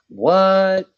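high, loud startled what; shocked what. Retold or imitated. Patient hearing the doctor's warning, shocked. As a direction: blurt it out in disbelief. Shocked at being told could die.

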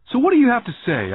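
a small kid acting brave; demanding. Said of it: "I demanded".

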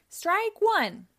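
high, loud umpire call; excited shout. Acted. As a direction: call the strike out across the field. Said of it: "shouted Sonic".

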